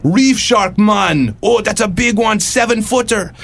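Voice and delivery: high, hammed-up Caribbean accent; excited, badly faked Caribbean lilt; terrible Caribbean accent. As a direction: shout it like an islander in a bad Caribbean accent. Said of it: "I'll do a terrible Caribbean accent".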